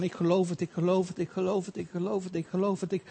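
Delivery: mimicked passive believer, very soft repetitive murmur; muttered mantra. Preacher mimicking people waiting at home, muttered mantra. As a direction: repeat it softly under the breath, imitating idle belief.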